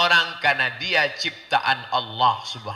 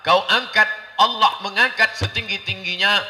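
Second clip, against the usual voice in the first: raised, higher preacher's voice, Arabic drawn out; impassioned preaching; 8 semitones higher, 8 dB louder than usual. Real, not acted. Preacher telling a crowd God raises and lowers, impassioned. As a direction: preach it at full force.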